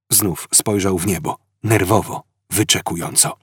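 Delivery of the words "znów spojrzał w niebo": plain reading voice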